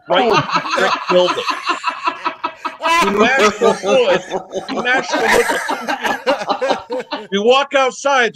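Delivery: high-pitched words broken by laughter; laughing while telling. Real, not acted. Telling friends about the crash, laughing hard. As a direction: laugh through the story of the crash.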